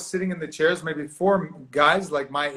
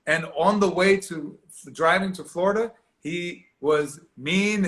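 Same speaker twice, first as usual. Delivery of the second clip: usual speaking voice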